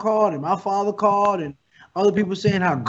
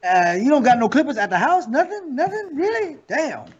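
rising higher and higher in mock disbelief; 9 semitones higher than usual. A friend mocking his quarantine haircut, incredulous and climbing high. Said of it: "I can't have that quarantine look like".

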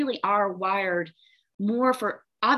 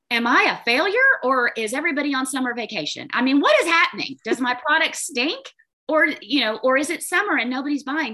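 higher and louder, anxious questions tumbling out; panicked self-talk; 6 semitones higher, 4 dB louder than usual. Retold or imitated. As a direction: fret aloud like a worried business owner. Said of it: "in those moments and say".

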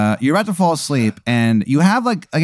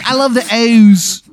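put-on high, loud, drawn-out Maryland accent; bad Maryland accent; 12 semitones higher, 7 dB louder, slower than usual. Shithead Steve declaring love for the Orioles, bad Maryland accent. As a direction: belt it slowly in a silly Maryland twang. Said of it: "it's the worst Maryland accent".

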